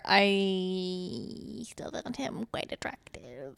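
soft, slow, a little embarrassed; sheepish admission. Real, not acted. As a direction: confess it quietly and slowly. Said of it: "confessing attraction, slow and soft".

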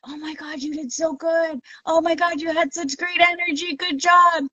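high-pitched retold congratulations; high, admiring gush; gushing praise. Retold praise from other racers.

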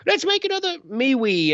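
very high squeaky mock whine; mock whiny complainers. People mad at Facebook whining about censorship, mocking high whine. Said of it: "They're like".

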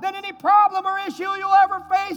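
loud, high shouted preaching; preaching at full force. Acted. Preacher shouting to the church at the climax. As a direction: build to a thunderous peak.

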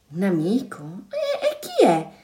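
put-on doubtful, stammered child's question; unconvinced. A narrator's reading. Two children stopping, asking about the friend, unconvinced. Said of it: "poco convinti".

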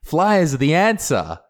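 high, hammed-up revelation; pumped up. Comedian recalls hitting on flyering idea, pumped up. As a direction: proclaim it high, with mock zeal. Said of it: "I was all pumped up".

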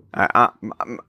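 ordinary talk, nothing marked

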